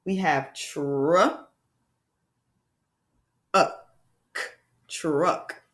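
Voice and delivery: segmented slowly into sounds; sound-by-sound segmenting; sounds tapped out. Shown as a demonstration. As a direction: tap out each sound, tr, uh, k, then blend. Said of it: "how we would spell truck".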